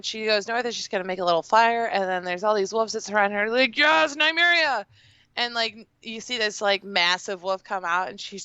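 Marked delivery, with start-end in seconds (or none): 3.8-4.8 high, drawn-out cheer; excited cheer. Retold or imitated. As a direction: cheer for the wolf's return, pitched up and slow. Cheering at the wolf's return.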